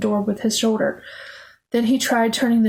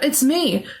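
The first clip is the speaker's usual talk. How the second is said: high, urgent calls, climbing higher; raised, frantic pleading; shouting; 6 semitones higher than usual. A narrator's reading. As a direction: shout more desperately with each phrase. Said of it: "George shouted".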